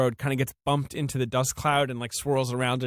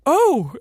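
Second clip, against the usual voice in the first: gasp; 12 semitones higher, 6 dB louder than usual. Retold or imitated. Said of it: "I went".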